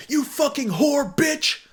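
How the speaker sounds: high, loud-edged mock tirade; hammed-up outburst, drawn out and high; mock furious insult. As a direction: imitate an enraged messager hurling abuse. Mimics abusive messager before his apology.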